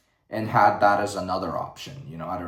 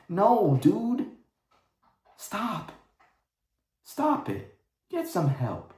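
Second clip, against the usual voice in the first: exasperated pleading; 11 semitones higher, slower than usual. Retold or imitated. Exasperated retort to the studio.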